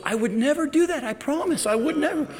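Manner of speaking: put-on high, earnest little-girl promise; young daughter. Daughter insisting to her parents, high earnest promise. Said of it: "And she goes".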